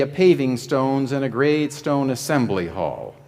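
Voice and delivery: ordinary talk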